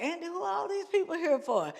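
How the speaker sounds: high, quick mock bewilderment; amazed questioning. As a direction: act out gaping at the crowd. Amazed at packed stadium, retold.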